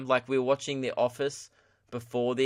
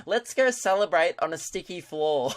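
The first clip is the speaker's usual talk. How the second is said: higher, airy mock of a friend; mock friend quote; 4 semitones higher than usual. Friend's breezy line to him, lightly mocked. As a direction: toss it off like her friend. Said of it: "she's like".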